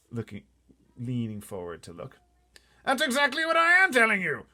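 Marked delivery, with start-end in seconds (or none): 2.8-4.5 blustering officer exploding; shouting Air Force chief. Acted. Air Force head shouting at the pilot, outraged. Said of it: "cried the Air Force man".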